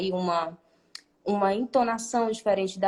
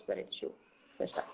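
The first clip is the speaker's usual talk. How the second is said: very quiet, barely voiced aside; muttered aside; 12 dB quieter, faster than usual. Real, not acted. Teacher pausing to close something, muttered aside. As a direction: murmur it to yourself.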